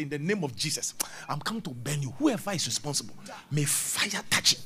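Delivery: unvoiced, hushed mock prayer; whispered prayer. Preacher acts out a prayer over a charm, whispering. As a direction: whisper the curse like a secret rite.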